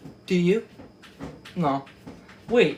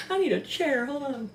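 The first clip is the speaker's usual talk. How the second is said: normal talking voice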